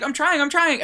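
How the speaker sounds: high, loud mock-flustered protest; acted panic. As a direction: imitate someone frantically searching under pressure. Retold panicked search for keys.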